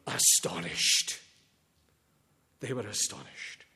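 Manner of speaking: dropped low and soft, almost breathed; a hushed, awed echo; hushed awe. Acted. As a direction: let the wonder sink in quietly. Preacher dwells on disciples' awe.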